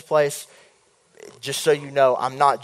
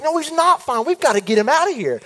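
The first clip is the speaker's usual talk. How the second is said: higher, insistent protest; 12 semitones higher than usual. Retold or imitated. Insisting to parents the wizard must go, higher insistent. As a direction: plead firmly.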